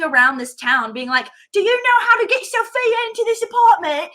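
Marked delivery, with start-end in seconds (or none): 1.5-4.1 high, loud imitated British driver; British accent. Poor driver asking around town for help, high British accent. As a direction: plead with passers-by in a raised British accent. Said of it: "I'm loving your British accent".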